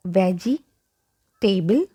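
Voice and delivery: veggie, table said as separate full words; mispronunciation. Shown as a demonstration. Teacher rejects the wrong syllables, mispronunciation. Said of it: "We don't have".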